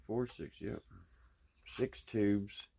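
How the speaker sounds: ordinary delivery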